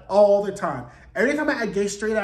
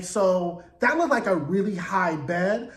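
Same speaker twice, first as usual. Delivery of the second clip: usual speaking voice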